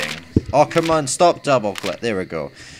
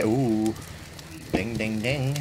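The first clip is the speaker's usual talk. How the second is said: ordinary talk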